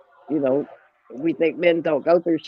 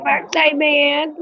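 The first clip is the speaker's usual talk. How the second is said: slow, high and drawn out; playful high drawl; 10 semitones higher, 4 dB louder, much slower than usual. Acted. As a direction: stretch it out in a high singsong. Odd drawn-out phrase.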